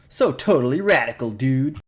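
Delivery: put-on totally-radical dude voice; hammed-up nineties slang. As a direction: play it like a rad nineties dude. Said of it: "internet tech was either nerd speak or".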